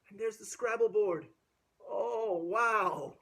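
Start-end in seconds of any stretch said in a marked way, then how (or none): none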